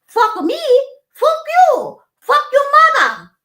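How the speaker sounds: shrieking, very high and loud mock tirade; waitress screams at Jody, hammed-up, very high and loud; yelling in broken English. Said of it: "yelling at Jody in that horribly broken language".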